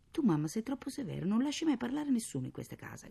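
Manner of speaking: put-on high, protesting child's cry; shouting. A narrator's reading. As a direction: protest at a too-strict mother. (protesta gridata, acuta)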